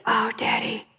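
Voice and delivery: soft unvoiced little girl; whispered plea. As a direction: breathe it like a sleepy child.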